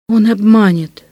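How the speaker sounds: ordinary delivery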